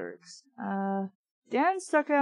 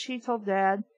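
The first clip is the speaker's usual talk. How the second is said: ordinary delivery, nothing marked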